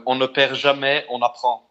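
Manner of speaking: "On ne perd jamais, on apprend" said in native French; saying in French. Shown as a demonstration. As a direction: give the French version of the saying, in French. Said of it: "Say it in French if you could".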